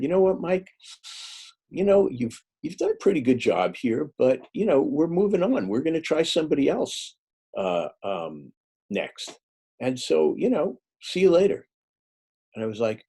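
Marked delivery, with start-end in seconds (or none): 0.0-11.6 lightly voiced breezy editor, higher; a hint of a casual boss letting him go; dismissive new editor. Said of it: "the guy who took over for her said".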